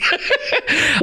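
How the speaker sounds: sudden high burst of laughter; laughing. Real, not acted. Host laughs at caller's joke.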